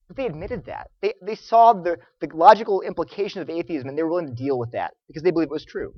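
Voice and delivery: usual speaking voice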